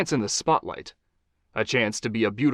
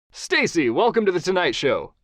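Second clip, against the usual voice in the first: higher, upbeat host voice; talk-show host Johnny; 7 semitones higher, 4 dB louder than usual. Acted. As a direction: charm the guest as a TV host.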